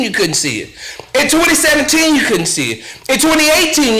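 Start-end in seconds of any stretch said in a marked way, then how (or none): 0.0-0.7 low, softer "you couldn't see it"; dropped low refrain. Acted. Host's rhetorical refrain, low and soft. 2.1-2.8 low, softer "you couldn't see it"; dropped low refrain. Acted. Host's rhetorical refrain, low and soft.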